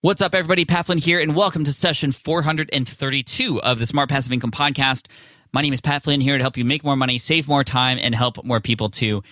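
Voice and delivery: upbeat host opening, pitched high; podcast intro. Acted. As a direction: welcome listeners like a radio host.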